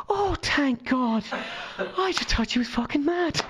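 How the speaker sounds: high, drawn-out mock relief; imitated relief. His mother learns the truth, imitated high and relieved.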